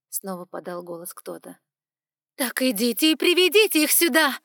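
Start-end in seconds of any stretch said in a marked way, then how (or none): 2.3-4.4 loud, high, furious bark. A narrator's reading. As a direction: snap the order out, fed up. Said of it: "рявкнула окончательно выведенная из себя Эванс".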